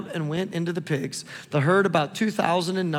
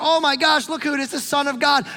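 high, loud mock alarm; hammed-up awe and alarm; imitated terrified demon; 7 semitones higher, 3 dB louder than usual. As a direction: act out a startled demon, pitched up. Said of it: "begging and pleading on his knees, terrified".

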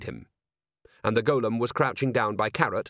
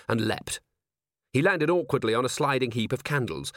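ordinary delivery

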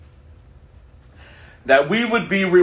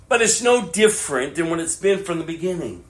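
ordinary talk

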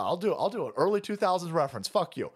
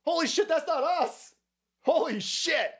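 high mock-panicked denial; hammed-up Israel spokesman insisting innocence; 10 semitones higher than usual. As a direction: squeal it like a caught liar. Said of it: "and they go".

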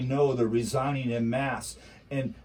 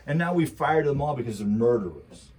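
ordinary talk

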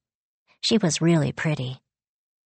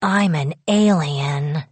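ordinary delivery, nothing marked

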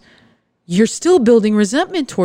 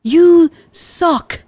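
You suck shouted higher and louder; louder, rising frustration; 9 semitones higher, 4 dB louder than usual. Pretend. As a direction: hit You suck harder the second time. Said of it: "just tell them, like".

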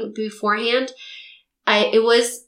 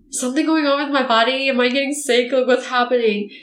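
worried gasp, then anxious higher-pitched questions; mock hypochondriac alarm; mild panic; 4 semitones higher than usual. Self-confessed hypochondriac wondering if she is getting sick, mildly panicked. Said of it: "I think I panicked a little bit".

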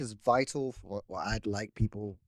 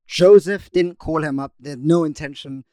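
mock indignant; 4 semitones higher, 7 dB louder than usual. Mock roast of friend.

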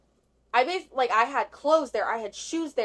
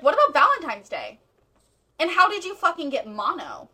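high, loud replayed interrogation; accusing retold questions; 5 semitones higher, 7 dB louder than usual. Girlfriend grilling boyfriend about Valentine's Day, accusing. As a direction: confront him, raising your voice.

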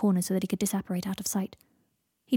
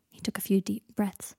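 ordinary delivery

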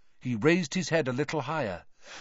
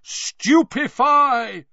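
loud, high, long-drawn roar; roared spell; 13 semitones higher, 10 dB louder, much slower than usual. Acted. Twenty wizards firing at once, roared. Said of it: "roared twenty voices".

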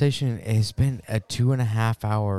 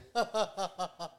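high laugh; 6 semitones higher, 12 dB quieter than usual. Real, not acted. Reacting to the rimming story.

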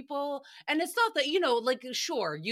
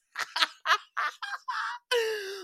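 shrieking laugh; 13 semitones higher than usual. Real, not acted. Podcaster cracking up mid-story, shrieking laugh. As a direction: double over laughing.

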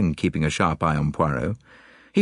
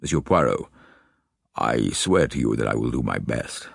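deep, solemn lord; Lord Alloway; 3 semitones lower than usual. Acted. Lord swearing to the detective, deep solemn voice. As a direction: vow it low and gravely.

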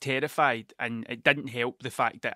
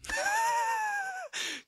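a high shrieking burst of laughter; 5 dB quieter than usual. Real, not acted. Telling listeners the drinks held acid, shrieking laugh. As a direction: cry out laughing at the memory.

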